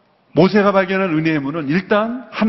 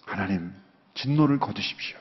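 quiet plea; 9 semitones lower, 9 dB quieter than usual. Pretend. Moses pleading with God.

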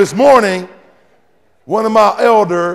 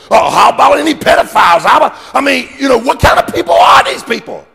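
loud, high, rapid-fire questions; preaching at full force; 5 semitones higher, 4 dB louder than usual. Acted.

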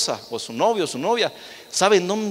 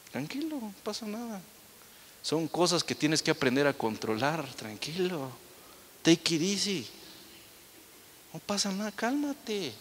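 soft soothing calm-down to an angry brother; calm reassurance; 6 dB quieter than usual. Retold or imitated. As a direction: talk him down gently. Calmly reassuring the aggressive brother.

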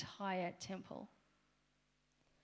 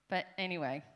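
ordinary talk, nothing marked

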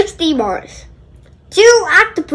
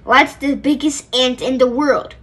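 ordinary delivery

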